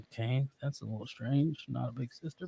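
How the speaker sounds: hushed private thought; puzzled musing. Retold or imitated. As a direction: drop the volume and quietly puzzle it out. Acts out private puzzlement at shirt.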